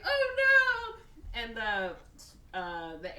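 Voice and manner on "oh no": high, loud hammed-up panic; mock panicked wail. Passengers see the engines on fire, mock panicked wail.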